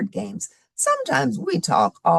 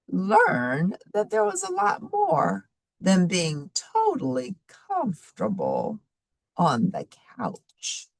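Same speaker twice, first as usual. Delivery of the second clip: put-on reading to children; 5 semitones higher, slower than usual. Teacher recaps story for children.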